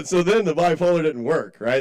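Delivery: normal talking voice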